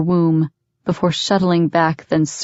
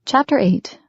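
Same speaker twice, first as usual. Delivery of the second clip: chapter heading; 7 semitones higher than usual. A narrator's reading.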